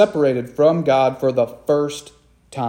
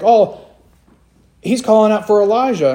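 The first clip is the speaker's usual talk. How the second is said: higher, louder, quick mock bystander voice; confused bystanders; 6 semitones higher, 4 dB louder than usual. Preacher mimicking confused bystanders at the cross. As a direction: play the puzzled crowd, a bit hammed-up. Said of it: "so they think".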